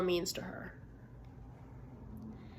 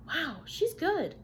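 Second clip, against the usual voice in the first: slow, higher, drawn-out awe; amazed kids; 6 semitones higher, much slower than usual. Acted. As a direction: marvel at her like an impressed child. Children's amazed thought in story.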